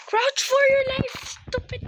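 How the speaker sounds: high, loud frantic cry; panicked yell. Real, not acted. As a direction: scream it in panic. Panicked yelling at teammate.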